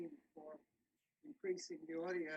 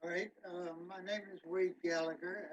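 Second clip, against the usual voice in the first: ordinary talk, nothing marked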